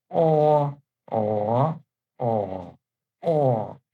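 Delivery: o level, rising, dipping, then falling; o in four tones. Shown as a demonstration. Teacher reading the row aloud, o through four tones. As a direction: run o through all four tones in turn. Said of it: "pay the attention, uh, to the tones".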